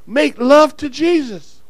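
ordinary delivery

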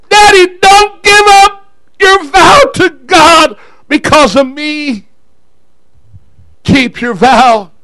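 high, loud crying-out to Daddy; impassioned acted plea, starting high and easing; daughter's urgent plea. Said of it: "She began to remind him".

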